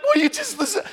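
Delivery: high, pleading will you just listen; exasperated parent. Retold or imitated. Parent begging a stubborn child to listen. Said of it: "And so often I'm like".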